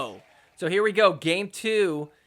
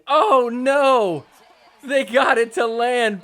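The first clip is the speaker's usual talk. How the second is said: louder and slower, laughing in disbelief; laughing shock; 5 semitones higher, 7 dB louder, slower than usual. Real, not acted. Commentator laughing in shock at a lucky shot.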